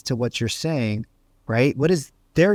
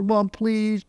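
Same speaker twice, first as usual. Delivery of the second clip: put-on higher, whiny little-kid plea; child pleading sweetly, pitched up; puppy dog pleading; 6 semitones higher than usual. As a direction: beg with a curled lip. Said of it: "puppy dog eyes looking up, big lip curled over".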